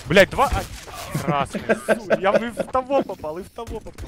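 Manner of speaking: high, slow, tense callout; excited high callout. Real, not acted. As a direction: stretch the words out in excitement. Tense firefight, calling hits.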